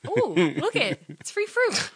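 put-on light up like a kid finding treasure; excited delight. Spotting free fruit on a walk, gleefully excited.

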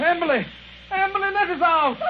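frantic high shout; shouting for help. Acted. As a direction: call out desperately to be freed. Locked in, calling to Emily.

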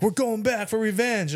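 high, hammed-up battle cry; mock revenge call. The friend rallying them for revenge, mock heroic. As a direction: play the hothead rallying for payback.

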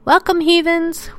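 high, loud gleeful welcome; excited shout. Acted. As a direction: greet the crowd with glee. Host's big welcome.

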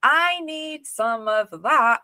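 high, drawn-out, keen reaction; retold eager realisation. Host retelling her reaction to the guest, eager and drawn-out.

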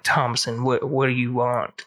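hint of a cool dark stranger; cool suave cowboy. Shown as a demonstration. The devil as a cowboy speaks to Thomason, low and cool. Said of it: "he's actually all cool".

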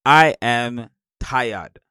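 loud, drawn-out declaration of tiredness; slow, emphatic admission; emphatic tired. Real, not acted. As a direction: stretch each word out wearily and firmly. Emphatic answer on being exhausted.

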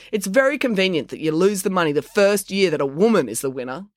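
imply it is far too convenient; sarcastic. A narrator's reading. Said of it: "said Maya Darawal sarcastically".

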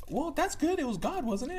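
high-pitched mother voice; imitated mom. Voicing his mom.

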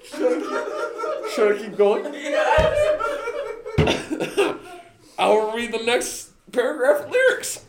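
high, giggly words; can barely hold the laughter; laughing. Real, not acted. Asking friends whether to go on, still laughing.

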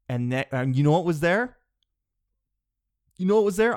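rising loud and high with excitement; building suspense. Retold or imitated. Lost hiker telling police his rescue story, excited build-up.

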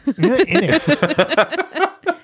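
very slow, high drawn-out "not"; stretched out not. Real, not acted. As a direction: draw out "You're not in it" slowly. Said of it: "repeated slower, drawn out".

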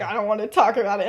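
low, quick dismissal; back to angry. Retold or imitated. She turns back to James after boyfriend leaves, curtly angry. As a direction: imitate curt, contained anger. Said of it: "back to being angry".

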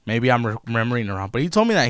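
ordinary talk

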